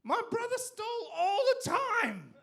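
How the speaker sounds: high, loud proud declaration; proud boast. Acted. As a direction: crow it out with pride. Boasting punchline.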